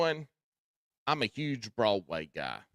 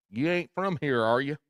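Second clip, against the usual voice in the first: mock suspicious server; 4 semitones higher, 7 dB louder than usual. Server slams plate on outsider.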